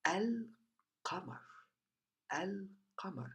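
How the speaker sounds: very soft, nearly whispered; shy hush. Shown as a demonstration. Teacher showing the resting lam in al-qamar, shy hush. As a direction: murmur it gently, slipping toward a whisper. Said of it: "it appears very shyly".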